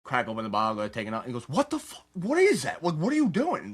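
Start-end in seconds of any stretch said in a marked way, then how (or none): 1.5-3.6 retold dad's outburst, pitched much higher; mock-shouting the father's alarm; imitating angry dad. Retelling his dad catching him with pills, high mock outrage.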